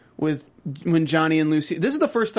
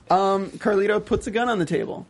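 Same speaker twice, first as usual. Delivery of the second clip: ordinary talk, nothing marked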